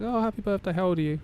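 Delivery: high, bright mock manager; manager's voice. Manager asking his age, voiced high and chirpy. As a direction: pitch way up to voice the manager. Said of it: "my manager was like".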